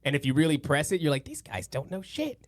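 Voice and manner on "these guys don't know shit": a higher but much softer, slowed dismissive aside; muttered realization. Retold or imitated. Pressing science until you realize they don't know, a muttered scoff.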